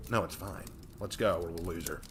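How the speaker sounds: ordinary delivery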